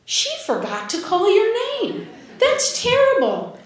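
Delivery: high, indignant mother's voice. Retold or imitated. Mother to daughter at graduation, high and indignant. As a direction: sound shocked on her daughter's behalf.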